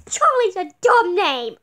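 put-on loud, outraged child shout; indignant kid yell.

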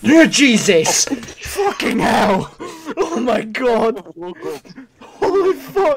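startled cry. Real, not acted. Jump scare in horror game.

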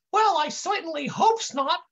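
high, dim-witted intern protest; high kid voice. A narrator's reading. Confused kid denies coughing on anybody, high voice. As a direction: keep it way up, dopey and indignant. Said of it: "the kid says in this high voice".

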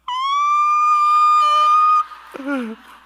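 long, loud, very high squeal; high-pitched squeal. Retold or imitated. Preacher acting his reaction to "get you down here", squealing. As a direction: hold one piercing high note in mock shock. Wordless reaction to being invited.

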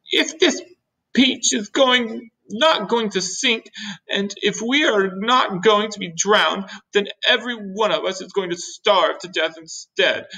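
high, halting, wailing forecast of doom; doom-laden earthworm. Acted. Voices the gloomy earthworm.

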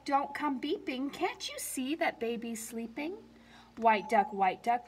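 ordinary delivery

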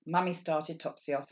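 usual speaking voice

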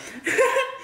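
high burst of laughter. Real, not acted. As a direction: laugh out at the joke. Laughs at own comment.

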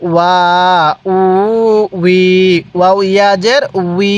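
"ওয়া, উ, উই" each held long and level; measured syllable chant of "ওয়া, উ, উই"; drawn-out vowels. Shown as a demonstration. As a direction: stretch "ওয়া, উ, উই" with pauses between. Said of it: "এরকমভাবে শিখব".

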